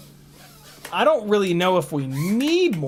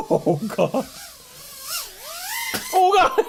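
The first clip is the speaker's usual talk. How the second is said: laughing; slower than usual. Real, not acted. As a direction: burst out laughing. Laughing at drone's loud sound.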